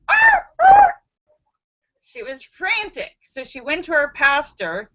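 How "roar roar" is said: high, loud squawking roar; high loud bird screech; parrot roar. Acted.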